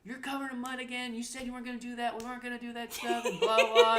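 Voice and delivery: high, rattled-off imitated scolding; mock screaming. Mom scolding her muddy son, mock-angry. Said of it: "they were screaming at each other".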